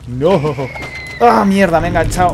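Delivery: loud startled shout; frustrated yell. Real, not acted. Caught by enemy, frustrated yell.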